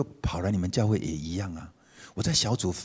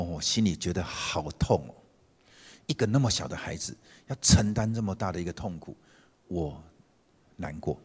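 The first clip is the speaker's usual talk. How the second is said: tender sorrow; 3 semitones lower, 4 dB quieter than usual. Retold or imitated. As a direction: say it gently, aching. Pastor comforting the man.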